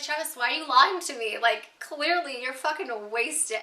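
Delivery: put-on loud, slow accusing yell at a drunk fiance; retold angry yell. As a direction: call him out, furious and fed up. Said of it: "And I'm like".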